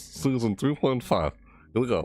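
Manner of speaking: ordinary delivery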